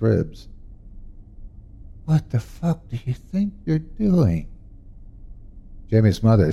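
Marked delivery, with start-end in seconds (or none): 2.0-4.5 furious hiss. Acted. Mother finds father at son's bed.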